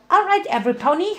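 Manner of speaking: high, fast rallying shout; cried out. Acted. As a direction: fire it out high and quick. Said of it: "Pinky cried".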